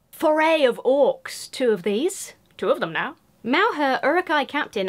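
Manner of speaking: loud, gushing card names; excited loud. Real, not acted. Loudly announcing orc cards to viewers. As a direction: call them out with glee.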